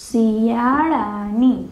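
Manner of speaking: drawn out slowly for writing; slow teacher dictating; slow dictation. Shown as a demonstration. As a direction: dictate the answer word slowly. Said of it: "તો જવાબમાં શું લખવાનું થશે?".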